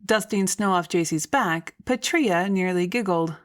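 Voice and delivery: usual speaking voice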